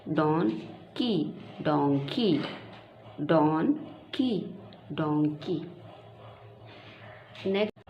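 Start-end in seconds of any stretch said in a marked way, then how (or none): 0.1-2.4 slow, stretched की then donkey; drawn-out model for a child; slow syllables. Shown as a demonstration. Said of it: "जैसे pronounce करते हैं". 4.2-5.6 slow, stretched की then donkey; drawn-out model for a child; slow syllables. Shown as a demonstration. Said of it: "जैसे pronounce करते हैं".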